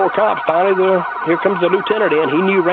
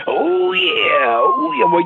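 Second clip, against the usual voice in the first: much higher, rasping wrestling-promo voice; Randy Savage impression; much slower than usual. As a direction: ham up the wild wrestler catchphrase. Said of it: "sounded like a crackhead".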